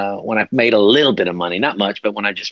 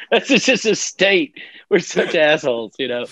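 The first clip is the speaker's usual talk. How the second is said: laughing; 7 semitones higher than usual. Real, not acted. Laughing after his own old joke.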